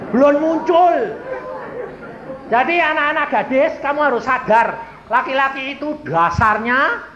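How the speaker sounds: high, loud pulpit delivery; full-force preaching. Real, not acted. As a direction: drive it home to the whole congregation. (khotbah lantang berapi-api)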